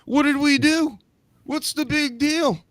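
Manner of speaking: very high squeaky mock protest; protesting impression. Host mimics the New Day facing boos, high-pitched protest. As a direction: play innocent in a high falsetto.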